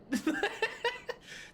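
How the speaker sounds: airy rising giggle; high breathy laugh. Real, not acted. Laughing at own wordplay.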